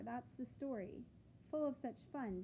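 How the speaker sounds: ordinary delivery, nothing marked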